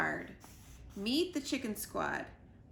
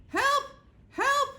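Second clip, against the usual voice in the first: high, loud frantic cry of help; panicked cries for help; 9 dB louder than usual. Acted. Squirrel bursting into the coop, crying for help in panic. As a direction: yell for help as if chased.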